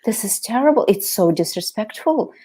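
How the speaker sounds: high, appalled teacher's protest; horrified teacher. Retold or imitated. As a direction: act out the shocked music teacher. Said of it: "She was like".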